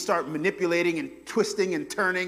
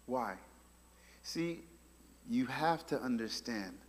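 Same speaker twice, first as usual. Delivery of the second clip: ordinary delivery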